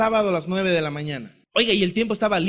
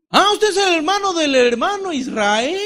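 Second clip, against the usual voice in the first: mock surprise; 10 semitones higher, 5 dB louder than usual. Imitating a surprised church member.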